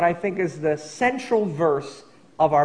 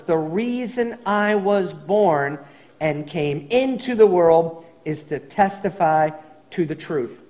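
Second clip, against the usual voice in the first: slow, weighty quote of the verse; slow and deliberate. Shown as a demonstration. Pastor stressing Jesus' purpose to the church, slow and deliberate. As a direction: spell out the purpose word by word. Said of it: "wanted to draw attention to".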